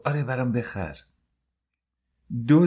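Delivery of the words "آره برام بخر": put-on higher, eager childlike voice; childlike excitement. Wife accepting her husband's treat, childlike excitement. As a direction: answer like a delighted child.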